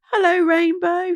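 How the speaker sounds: high, loud call over the sax; shouted. Acted. Little Wee shouts to Rainbow over the saxophone, loud. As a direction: shout it above the noise. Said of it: "shouted little Wee above the noise".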